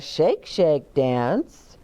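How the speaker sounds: ordinary delivery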